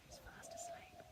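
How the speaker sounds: soft whispered goodnight. Shown as a demonstration. Reading to a child, whispering at bedtime. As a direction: whisper so you don't wake them. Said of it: "but much quieter".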